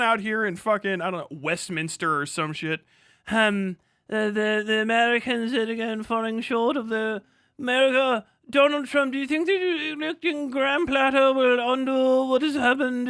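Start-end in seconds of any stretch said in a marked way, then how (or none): 3.3-13.1 mock reporter. Streamer mocking British press asking Americans, put-on reporter voice. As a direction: ham up a foreign press reporter.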